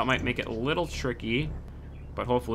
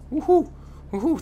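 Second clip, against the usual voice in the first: high, delighted woohoo; excited cheer; 14 semitones higher than usual. Real, not acted. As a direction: cheer it out in relief. Cheering after clearing an obstacle.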